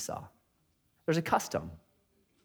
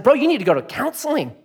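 raised, higher voice, incredulous; exasperated pastor; 8 semitones higher than usual. Retold or imitated. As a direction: push back hard on him, half laughing. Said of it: "I said".

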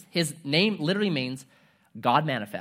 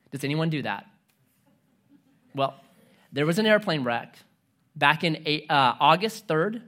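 ordinary talk, nothing marked

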